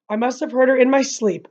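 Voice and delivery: usual speaking voice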